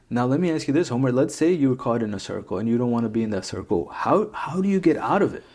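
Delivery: ordinary talk, nothing marked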